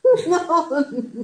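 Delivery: loud, high bright laugh; a hearty real laugh; burst of laughter. Real, not acted. Laughs at the joke about purity.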